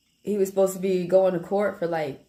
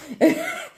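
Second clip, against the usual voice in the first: sudden high laugh; high burst of laughter. Real, not acted. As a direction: let a laugh pop out high. Laughs at own joke.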